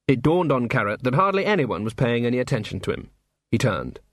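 usual speaking voice

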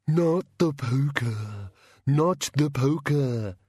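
slow, drawn-out monster plea; slow creature begging; pleading bogeyman. Acted. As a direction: beg as a cowed bogeyman, stretching the words.

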